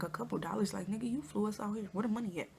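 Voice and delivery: soft, disbelieving mutter with a rising dollars?; under-the-breath retort, asking dollars? with an upward lilt; quietly indignant, questioning the cheap offer; incredulous retort. Retold or imitated. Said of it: "I'm like".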